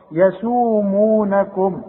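يسومونكم with each long vowel held the same length, slowly; even natural madds. Shown as a demonstration. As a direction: stretch يسو and مو equally. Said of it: "شوف المدود دي". (مد طبيعي متساوٍ في يسومونكم)

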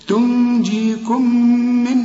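"تنجيكم من" joined on one long hummed m; slow recitation, humming through the doubled meem; merged double meem. Shown as a demonstration. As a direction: merge "تنجيكم" into "من" with held nasality. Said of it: "فبتضغم".

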